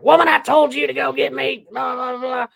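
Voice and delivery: very high, loud mock order; mock-bossy holler held up high; joking yell. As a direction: yell it at your wife as a running joke. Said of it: "And so I jokingly yelled".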